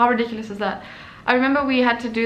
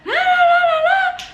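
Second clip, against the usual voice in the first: very high, loud shrieking rant in French; teacher screaming at a student in French, hammed-up; mock French screaming; 5 dB louder than usual. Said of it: "She's going off at me".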